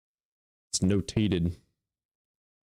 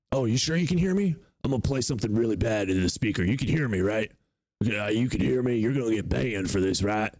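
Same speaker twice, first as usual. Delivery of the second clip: mocking the caller. Streamer mimicking a troll caller, teasing. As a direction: needle him like the sketchy caller. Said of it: "you're definitely about to do something bad".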